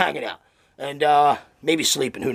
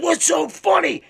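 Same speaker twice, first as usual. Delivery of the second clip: high, slow incredulous question; acted exasperated bewilderment; baffled demand; 12 semitones higher, slower than usual. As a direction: ask it slowly with the pitch far up. Farmer baffled by their laughter.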